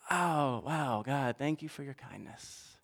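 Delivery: hushed grateful prayer. Acted. Humble grateful prayer voiced.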